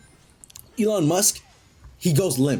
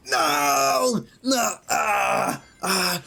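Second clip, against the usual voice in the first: high, frantic no; panicked cries; 9 semitones higher, much slower than usual. Acted. Sonic panicking during the countdown, high frantic cries. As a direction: cry out in panic.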